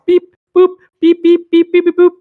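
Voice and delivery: high, loud robotic beeps; punchy high-pitched beep-boop noises; imitated button beeps. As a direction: mimic a machine's keypad in high beeps. Imitates pressing machine buttons.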